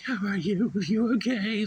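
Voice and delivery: very high, loud fretting mom voice; high-pitched hammed-up worried mother; mock fussing mom. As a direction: squeal it like a panicked mother. Imitating mom rushing to son.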